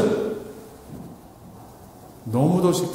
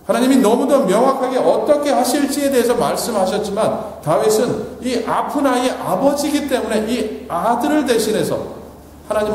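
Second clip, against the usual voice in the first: fervent preaching; 6 semitones higher, 3 dB louder than usual. Acted. Pastor urging the congregation, in fervent preaching. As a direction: press the point home from the pulpit.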